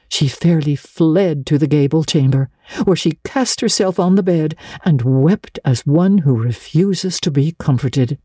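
ordinary delivery

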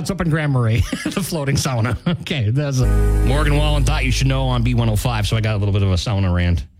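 ordinary delivery

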